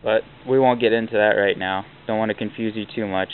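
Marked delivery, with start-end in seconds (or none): none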